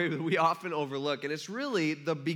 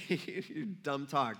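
laughing through it; 4 semitones lower, 5 dB quieter, slower than usual. Retold or imitated. Speaker laughs mid angel quote.

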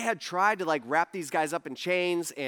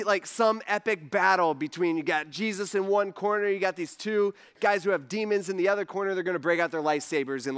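ordinary talk, nothing marked

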